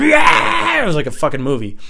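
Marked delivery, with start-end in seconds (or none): 0.0-0.8 high, loud screamed whoop; imitated cheer. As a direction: whoop like a car full of wild friends. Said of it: "everybody in the car is going".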